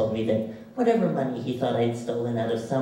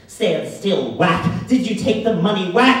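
shouting, louder and higher with each blow; father's furious shout; 7 semitones higher, 8 dB louder than usual. Retold or imitated. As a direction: yell it like a father beating his son. Father beating son, screaming.

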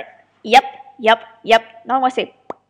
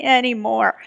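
ordinary talk

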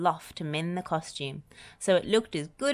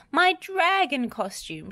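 dismayed; 11 semitones higher, 3 dB louder, slower than usual. A narrator's reading. Teacher dismayed at ruined costume.

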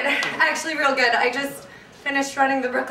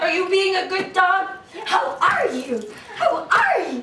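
higher, gushing talk to a pet; dog voice; 3 semitones higher than usual. Acted. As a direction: coo playfully at a beloved dog. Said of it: "that annoying dog voice".

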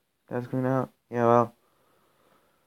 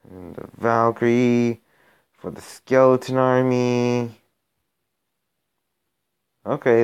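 usual speaking voice

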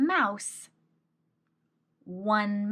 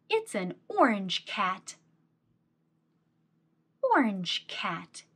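put-on reading to a toddler. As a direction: read it like to a small child. Reads picture book to child.

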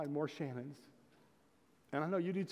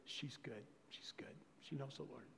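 hushed, near-whispered tearful words; humble, tearful; 2 semitones lower, 15 dB quieter than usual. Retold or imitated. Girl back in the car, tearfully whispering. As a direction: whisper it humbly through tears. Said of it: "real humble with tears in her eyes".

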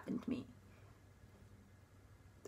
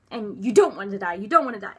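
retold screaming; 10 dB louder than usual. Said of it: "some of them were screaming at me".

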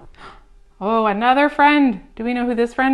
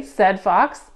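usual speaking voice

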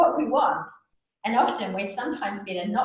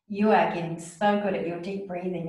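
ordinary delivery

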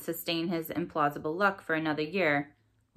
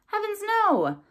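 high laughing exclamation, louder; 5 dB louder, slower than usual. Acted. Ivy laughing at Morgan's question, high laughing cry. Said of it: "Ivy laughed".

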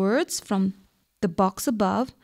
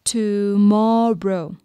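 Tomorrow very slow, in three drawn-out syllables; syllable demonstration; much slower than usual. Shown as a demonstration. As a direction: stretch Tomorrow into to, mor, row. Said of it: "How many syllables?".

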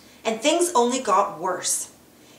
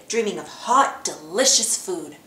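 plain reading voice

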